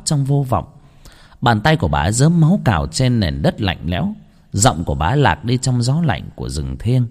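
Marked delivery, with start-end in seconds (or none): none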